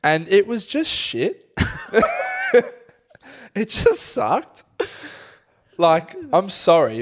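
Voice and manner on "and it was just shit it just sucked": laughing. Real, not acted. Laughing while complaining about juice.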